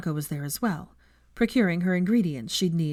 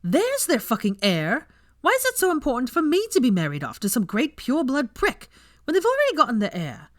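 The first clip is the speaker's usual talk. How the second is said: loud, high, angry tirade; heated outburst over an arranged marriage; furious rant; 8 semitones higher, 4 dB louder than usual. A narrator's reading. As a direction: rant it out, louder and higher. Ranting about marriage.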